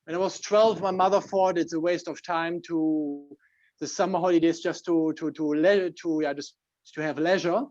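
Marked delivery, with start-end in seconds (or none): none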